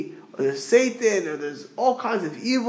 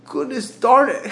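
mock exasperated curse; 6 semitones higher, 5 dB louder than usual. Mimics gentiles cursing when upset.

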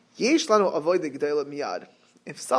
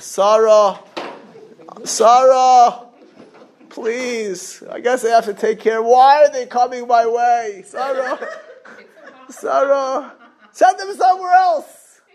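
raised, louder mock wail for help; exasperated calling out; 6 semitones higher, 6 dB louder, slower than usual. Mock Avraham begging Sarah to turn guests away, exasperated. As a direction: plead loudly as an overwhelmed host. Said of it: "it's not that Avraham said".

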